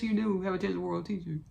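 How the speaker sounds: ordinary talk, nothing marked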